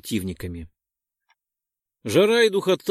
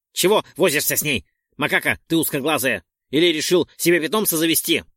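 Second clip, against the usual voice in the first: angry sneer; 3 semitones higher, 5 dB louder, faster than usual. Acted. Roldon sneers at Perk.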